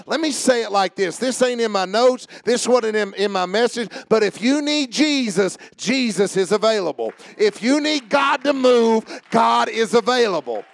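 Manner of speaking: preaching that climbs steadily in pitch and volume; building preaching. Real, not acted. Preacher promises congregation God is about to move, building preaching. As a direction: start firm and measured, build toward full voice.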